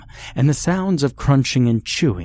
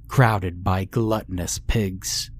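ordinary delivery, nothing marked

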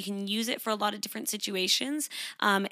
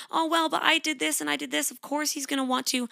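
higher, whiny making of excuses; self-blaming inner voice; 7 semitones higher, faster than usual. Retold or imitated. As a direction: rationalize it in a higher voice. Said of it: "If you're thinking".

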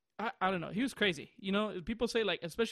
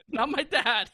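louder, higher laughing delivery; 4 semitones higher, 3 dB louder than usual. Real, not acted. Naming the hillbilly neighbor to a friend, laughing. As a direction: laugh out the punchline.

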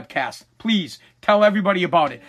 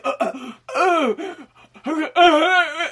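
slow hammed-up dying groans, higher; mock dying gasps; 8 semitones higher, much slower than usual. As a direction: groan out your last breaths for laughs. Dying man gasping in bed.

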